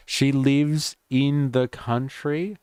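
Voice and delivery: slow, high incredulous question; mock disbelief. Host scoffing at the prize citation, mock disbelief. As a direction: pretend to be shocked she stayed home. Host mocks the announcement's claim.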